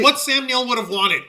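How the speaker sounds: excited. Real, not acted. Joking outburst.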